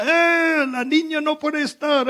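high-pitched mock yell at a customer; retold yell. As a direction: call out sharply, imitating the worker. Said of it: "y luego uno grita". (grito imitado y agudo)

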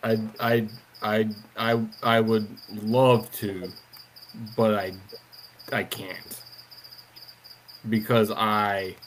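low, hesitant stalling with long gaps; halting stammer. Acted. Questioned character stalls the guard, halting stammer. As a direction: stumble over each start, searching for an excuse.

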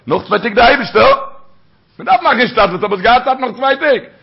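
high, loud mock protest; acted indignant outburst. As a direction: exclaim it loudly, pitch shooting up.

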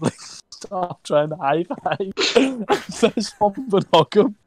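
high, slow, giggly retelling; laughing through it. Real, not acted. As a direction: struggle to get it out through laughter.

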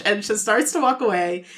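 ordinary delivery

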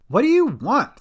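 high, loud demand; desperate demand. A narrator's reading. Cat confronts her friend's captor, desperate demand.

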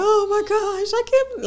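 high wailing sob-voice, imitating herself crying; a hammed-up retold crying fit, high and strained; mock bawling wail. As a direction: whimper it out like a breakdown, half-performed. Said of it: "bawling my eyes out".

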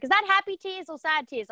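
high, quick imitation of a child asking; young daughter. Daughter questioning mum in the shower, imitated child voice. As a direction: pitch it up as a curious little girl. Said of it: "she's like".

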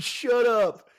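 high, loud, hammed-up snap; mock shut up. Pretending to snap at a friend, high mock anger. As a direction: fake offended snap.

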